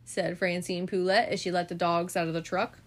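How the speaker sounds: usual speaking voice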